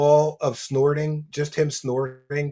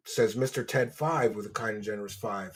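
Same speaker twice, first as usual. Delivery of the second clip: ordinary delivery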